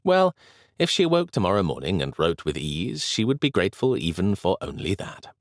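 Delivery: plain reading voice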